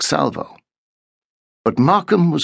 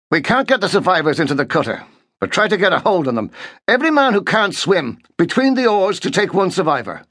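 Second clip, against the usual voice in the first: fast, higher urgent commands; urgent orders; 8 semitones higher than usual. A narrator's reading. Markham briefs his sergeant, fast and urgent. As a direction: rattle off the plan. Officer giving hurried orders.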